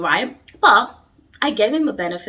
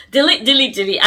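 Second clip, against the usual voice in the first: louder, punchy repeated delete; emphatic delete; 4 semitones higher, 6 dB louder than usual. Real, not acted. Vlogger ordering viewers to delete an ex, loud and punchy. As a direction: hammer each delete as a command.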